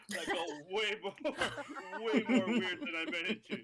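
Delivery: loud, laughing through words. Real, not acted. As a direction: crack up while talking. Laughing at own joke.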